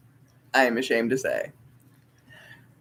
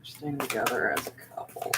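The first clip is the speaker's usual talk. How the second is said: murmuring; 11 dB quieter than usual. Real, not acted. Murmuring question over the cards.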